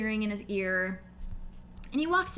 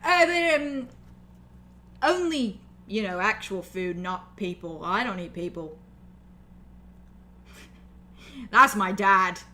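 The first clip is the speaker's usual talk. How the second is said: orc Mud joking; 5 dB louder than usual. Acted. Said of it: "He's like".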